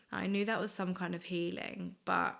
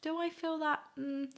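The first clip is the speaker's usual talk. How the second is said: doubtful musing; 8 semitones higher, slower than usual. Retold or imitated. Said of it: "And I think".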